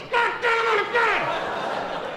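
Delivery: dad cursing. Retold or imitated. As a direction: yelp the curse like the panicked father.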